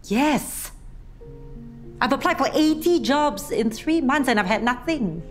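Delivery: loud, high and fed up; exasperated outburst. Acted. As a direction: burst out in frustration. Frustrated jobseeker outburst.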